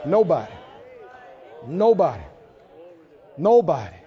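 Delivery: ordinary talk, nothing marked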